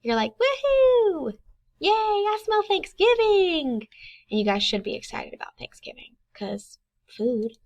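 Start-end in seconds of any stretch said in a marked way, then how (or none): 0.3-1.3 high, loud cheer; teacher cheering for a class; excited woohoo. Shown as a demonstration. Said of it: "it means you're excited". 1.8-3.9 much higher and slow, cheering "Thanksgiving"; teacher showing a class what an exclamation mark sounds like; excited. Shown as a demonstration. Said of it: "it means you're excited".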